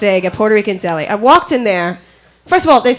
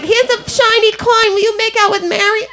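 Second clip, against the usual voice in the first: mock coaxing; 9 semitones higher than usual. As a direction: dangle a bribe in a high voice. Acting out someone coaxing with trinkets.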